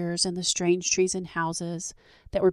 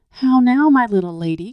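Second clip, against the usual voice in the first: cried out; 5 semitones higher, 6 dB louder than usual. A narrator's reading. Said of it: "cried Midas".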